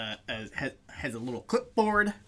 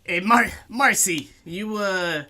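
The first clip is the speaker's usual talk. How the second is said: loud, high, pushy bouncer voice; orc bouncer; 8 semitones higher, 7 dB louder than usual. Acted. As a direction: call over to his partner, loud and rough. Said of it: "the orc says".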